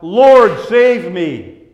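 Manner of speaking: a loud, desperate cry to be saved, the first word shouted. Acted. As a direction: shout it like a man sinking in the waves. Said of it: "as Peter cries out".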